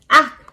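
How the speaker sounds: loud, high squawk; rooster's ack. A narrator's reading. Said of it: "said the rooster".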